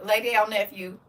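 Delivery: normal talking voice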